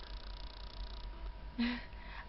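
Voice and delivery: soft airless laugh; quiet embarrassed laugh; breathy laugh. Real, not acted. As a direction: laugh under the breath at yourself. Laughing at the text.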